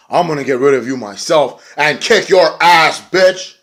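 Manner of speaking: loud threat building to the last word; mock fury, rising in pitch and force; imitated angry threat. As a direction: act out a pissed-off wrestler's challenge. Imitates pissed Maria threatening a rival.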